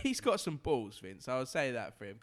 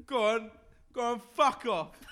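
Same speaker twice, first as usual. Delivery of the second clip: loud, higher "go on, fuck off" with a laugh breaking in; shouting pub lout; 8 semitones higher, 6 dB louder than usual. Acted. Pub lout telling a stranger to get lost, loud half-laughing shout. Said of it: "You imagine saying that in the pub".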